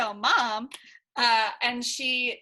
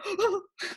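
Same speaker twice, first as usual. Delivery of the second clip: a high, stunned intake of breath; imitating a scandalized gasp; shocked gasp; 9 semitones higher than usual. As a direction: gasp as if you can't believe it. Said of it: "And I was like".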